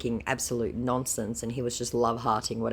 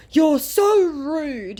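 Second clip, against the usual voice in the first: high, loud, slow whiny scammer voice; 13 semitones higher, 9 dB louder, much slower than usual. Acted. Scammer complaining she is rude, high slow whine.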